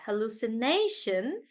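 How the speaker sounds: hallucination with NA stressed; stress on NAY. Shown as a demonstration. Teacher repeating hallucination, nay stressed. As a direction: put the weight on "nay" in hallucination. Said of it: "we stress on nay".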